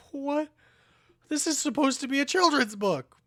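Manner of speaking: high, loud indignation; loud, high outrage at the book; outraged. Real, not acted. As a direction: protest it loudly, pitch way up. Outraged at sad children's book.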